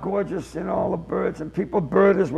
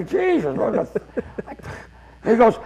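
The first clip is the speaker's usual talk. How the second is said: normal talking voice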